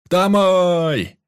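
high, drawn-out loud shout; crew's cheer. Acted. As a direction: cheer it back at full voice. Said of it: "Поддержала команда".

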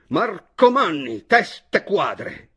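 loud, high, slow-hurled insults; furious shout. A narrator's reading. Chojnicki at the height of his fury, shouting. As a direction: bellow each insult in fury. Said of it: "gridò finalmente".

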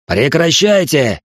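loud, slow furious bark; barked order. Acted. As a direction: bark it to stop them. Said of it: "рявкнул Арранкар".

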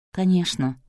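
plain reading voice

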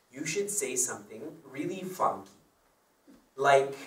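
usual speaking voice

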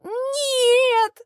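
high, loud sobbed no; sobbing. Acted. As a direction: wail it through mock tears. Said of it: "рыдаю я".

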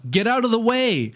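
high-pitched imitated warning yell; yelling like a kid on a hill, not full volume; retold yell. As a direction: call out a mock warning, pitch jumping up. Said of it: "and he just yells".

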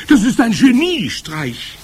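very high, loud crowd screech; shriek. A narrator's reading. Witch audience praising their leader, shrieking. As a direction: screech it in delighted admiration. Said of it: "kreischten sie".

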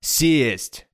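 understated barked order; a hint of a shouted command; mock yell. Herrera orders Paxton down, a mild yell. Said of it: "заорал Геррера".

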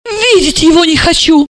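high-pitched angry outcry; indignant cry. A narrator's reading. Mother rejecting her son-in-law, crying out angrily. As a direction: cry it out in exasperation. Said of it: "она закричала".

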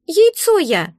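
high, squeaky outrage; indignant. A narrator's reading. Egg tells the heroine what it is, indignant. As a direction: announce it in an offended creature voice. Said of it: "возмутился подарок".

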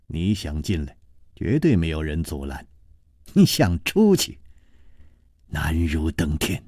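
low, soft, menacing sneer; cold sneer. A narrator's reading. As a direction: threaten them quietly with scorn. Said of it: "冷笑着说".

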